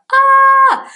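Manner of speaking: high, loud held "ah"; loud wrong-answer cry. Shown as a demonstration. Teacher rejecting a wrong sentence, loud high cry. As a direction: sound off like an error alarm.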